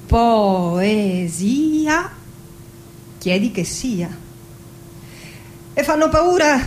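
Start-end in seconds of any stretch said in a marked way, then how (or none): 0.0-2.1 "Poesia?" stretched syllable by syllable, rising at the end; drawn-out questioning quote. Acted. Listener asking the poet what poetry is, slow puzzled question.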